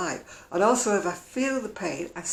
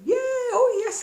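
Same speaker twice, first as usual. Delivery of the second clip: sarcastic yes; 6 dB louder, slower than usual. Acted. Sarcastic reply to donation critics.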